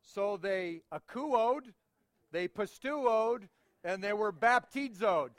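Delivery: akouoed, pisteuoed, baptizoed drawn out very slowly; slow teacher's drill with Greek verbs; slow playful drill. Shown as a demonstration. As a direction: stretch each made-up Greek verb. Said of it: "Can you say it with me?".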